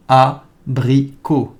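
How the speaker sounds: Abricot drawn out, a-bri-co, French r, silent t; slow French demo. Shown as a demonstration. Teacher slows Abricot down for repeating students, careful. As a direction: stretch Abricot so learners catch each sound. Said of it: "Please hear the words and repeat after me".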